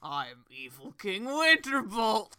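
slow, low villain reveal; menacing villain announcing himself; evil king voice. Retold or imitated. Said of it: "he's like".

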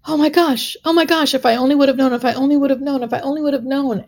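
thrilled high-pitched repeating; overjoyed exclamation. Retold or imitated. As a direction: gush like you can't believe your luck. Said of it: "he was so excited he couldn't finish his sentences".

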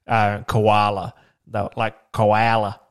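koala said the American way, drawn-out kuh-WAH-luh; slow American koala; American accent. Shown as a demonstration. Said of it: "stuff that they were sort of saying".

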